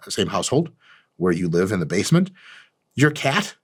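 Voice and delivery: ordinary talk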